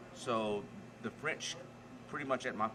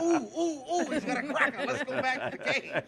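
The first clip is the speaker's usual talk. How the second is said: high, loud squealing pig voice; excited pig voice; 14 semitones higher, 7 dB louder than usual. Retold or imitated. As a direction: squeal like a greedy pig chasing a cracker. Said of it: "be like".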